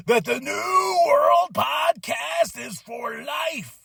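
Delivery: drawn-out promo yell; hammed wrestler promo. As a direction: sign off like a wrestling promo, brother. Wrestler-style sign-off.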